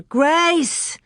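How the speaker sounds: high, loud building scream; girl searching alone. A narrator's reading. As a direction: scream her name across the grass. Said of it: "she screamed".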